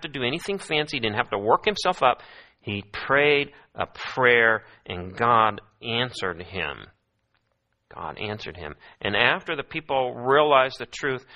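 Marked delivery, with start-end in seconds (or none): 2.6-6.9 very slow, weighty preaching; slow emphatic. Real, not acted. Preacher drives home the point. 7.9-8.7 much softer and lower; hushed repeat. Real, not acted.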